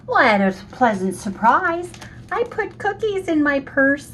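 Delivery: high, giddy reveal; bubbly little confession; excited. Real, not acted.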